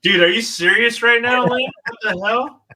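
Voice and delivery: high, loud shocked disbelief; real astonishment breaking out of him; stunned disbelief. Real, not acted. A collector shocked to find a sword named after him.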